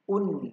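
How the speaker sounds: "Un" said short and ringing, nasal n at the end; tanwin un. Shown as a demonstration. Teacher models un for young pupils. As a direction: model the vowel u closing on n. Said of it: "Apa bacanya?".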